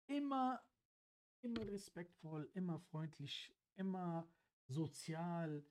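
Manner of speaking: soft, slow, deliberate tribute; earnest praise. Real, not acted. Listing Angelo's virtues to the group, earnest. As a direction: count off each virtue quietly and slowly.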